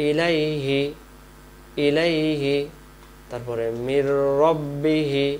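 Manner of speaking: usual speaking voice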